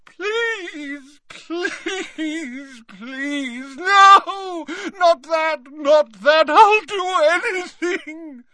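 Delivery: very high drawn-out wail bursting into a loud cry; Dumbledore screaming. A narrator's reading. Dumbledore hammering the ground, screaming pleas. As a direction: beg in agony, building to a scream. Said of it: "screaming again".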